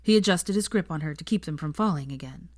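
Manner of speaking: plain reading voice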